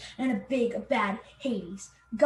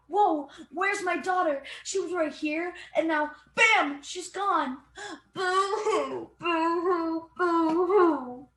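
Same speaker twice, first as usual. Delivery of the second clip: higher, hammed-up boo-hoo wail; mock crying mother; 6 semitones higher, slower than usual. Mother mocks her grief, hammed-up high sobbing. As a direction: fake sob over the lost daughter. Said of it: "Persephone's mother is like".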